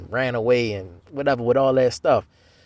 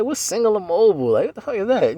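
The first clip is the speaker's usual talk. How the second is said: incredulous; 8 semitones higher than usual. Retold or imitated. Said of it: "I'm like".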